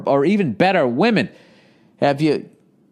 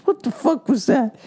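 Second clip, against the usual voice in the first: put-on girlfriend's voice; 10 semitones higher than usual. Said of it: "And she goes".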